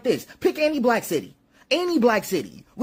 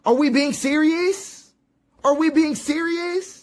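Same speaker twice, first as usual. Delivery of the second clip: slow, higher-pitched rhetorical disbelief; incredulous; 6 semitones higher, slower than usual. Real, not acted. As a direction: draw the question out in disbelief, pitch climbing at the end. Mock exasperated reply to quoted talk.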